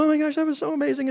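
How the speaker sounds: high, fast, thrilled; mock gushing after camp. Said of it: "and I would go".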